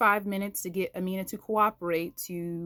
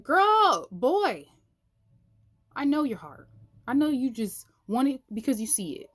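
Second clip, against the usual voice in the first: higher, louder, wise no-nonsense tone; sassy knowing reply; 6 semitones higher, 4 dB louder than usual. Retold or imitated. God answering a whining believer, knowing and sassy.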